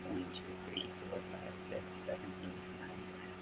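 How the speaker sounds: counting stitches in a whisper under the breath. Real, not acted. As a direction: whisper the numbers to yourself, fast.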